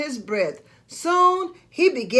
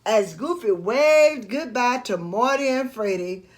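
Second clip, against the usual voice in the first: ordinary delivery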